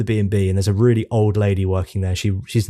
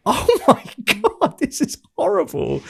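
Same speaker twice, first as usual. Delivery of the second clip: much higher, laughing 'Oh my God' in mock horror; horrified laugh. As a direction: act out a shocked laugh at the game. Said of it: "and it's like".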